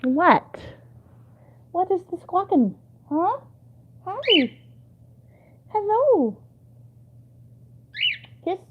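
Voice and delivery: high sing-song voice for the bird. Real, not acted. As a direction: coo at the parrot. Talking to the bird.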